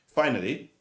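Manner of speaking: ordinary delivery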